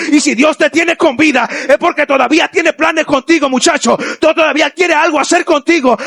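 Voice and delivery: put-on rally the young people to rise; preaching at full force. Preacher urging a fallen youth, fervent and rising.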